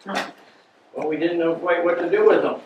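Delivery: ordinary talk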